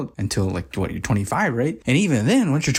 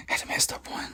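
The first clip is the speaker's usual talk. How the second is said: whisper; 10 dB quieter than usual. Real, not acted. Aside on disturbing scene.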